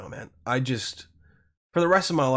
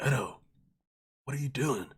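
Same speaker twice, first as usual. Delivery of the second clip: startled guest; 8 dB quieter than usual. Retold or imitated. Guest in shoes asking Edo, hushed and wary. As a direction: murmur it like a nervous guest. Guest reacting to his sword.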